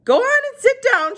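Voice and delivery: put-on high, far-off old woman voice; Gloria Dump voice. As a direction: pitch her up as an old lady. She said.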